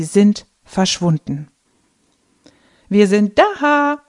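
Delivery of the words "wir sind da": bright, much higher cheerful call; cheerful grandpa. A narrator's reading. Grandpa cheerfully calls out arriving home. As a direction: announce your arrival happily. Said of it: "Opas fröhliche Stimme".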